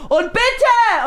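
shouted take call over a hushed crew; mock director shout. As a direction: yell the cue like a bossy director on set. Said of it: "Warum schreist du jetzt hier so?".

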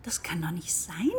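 breathy whisper to herself; a whispered disbelieving aside; whispered doubt. Acted. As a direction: hush it as a private thought. Inner doubt voiced to herself.

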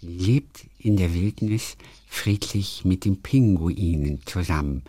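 very slow, measured animal expert on TV; Chimek impression. Imitating the great animal expert from German TV, very slow narration. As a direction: imitate a ponderous wildlife narrator, word by word. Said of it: "so hat Chimek doch gesprochen".

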